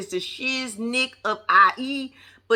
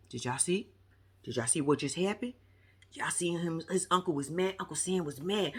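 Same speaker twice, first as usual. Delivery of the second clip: hushed gossip; 5 semitones lower, 10 dB quieter than usual. Retold or imitated. Chris gossiping to others.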